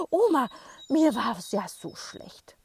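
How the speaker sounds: loud, slow, out-of-breath whine; breathless complaint. Acted. Boy tells grandma breathlessly, loud and slow. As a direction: gasp it out after running. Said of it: "sagt er atemlos".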